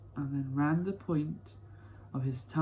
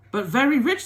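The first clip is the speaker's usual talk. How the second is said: put-on old grandma voice; 10 semitones higher, 8 dB louder than usual. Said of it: "said Grandma Georgina".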